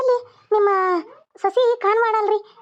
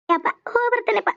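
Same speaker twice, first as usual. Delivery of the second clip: higher, weepy goodbye to father; tearful farewell; 5 semitones higher than usual. Acted. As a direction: take leave while holding back sobs. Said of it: "ಅಳಕ್ ಹೋಗ್ಬೇಡ". (ಅಳು ದನಿಯಲ್ಲಿ ವಿದಾಯ)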